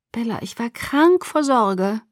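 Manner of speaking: worried mother. Acted. Tearful mother hugging her injured daughter, slow and worried. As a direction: draw it out with worry.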